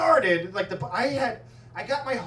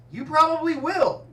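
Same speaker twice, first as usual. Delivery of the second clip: higher, louder teasing promise; smug neighbor; 8 semitones higher, 6 dB louder than usual. Retold or imitated. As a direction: tease it knowingly. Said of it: "And he goes".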